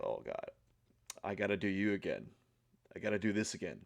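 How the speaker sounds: low, soft reluctant groan; weary dread. Retold or imitated. Talking to himself before the shower, low dreading mutter. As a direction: mutter it in dread at the cold water.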